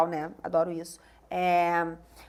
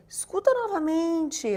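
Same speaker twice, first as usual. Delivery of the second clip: higher-pitched, drawn-out sweet request; sweet, endearing; 7 semitones higher, slower than usual. Acted. Asking someone to listen to an audio, sweetly. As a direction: ask it slowly in a dear little way. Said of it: "De um jeitinho querido".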